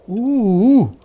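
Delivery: delighted ooh. Acted. Reacting to the smell.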